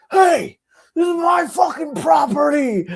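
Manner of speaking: high, loud, outraged yell; screaming neighbor. Retold or imitated. Neighbor screams at girlfriend over his property, furious mock shout. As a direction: imitate an irate neighbor screaming. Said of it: "she hears him screaming to himself".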